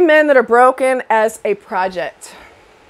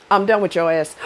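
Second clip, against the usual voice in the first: ordinary talk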